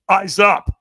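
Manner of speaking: loud barked order; sharp command. Retold or imitated. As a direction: snap him to attention. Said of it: "the trainer goes".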